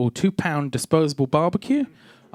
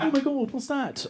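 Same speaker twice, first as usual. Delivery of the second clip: higher, slightly hushed mock amazement; mock astonishment; 8 semitones higher, 4 dB quieter than usual. Hipsters react to the sausage in the park, mock astonishment. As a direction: play a baffled onlooker, pitched up.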